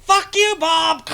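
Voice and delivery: high, loud hammed-up retort; mock yell. Bob yelling back in the imagined tong fight, mock shout. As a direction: fire it back across the tongs.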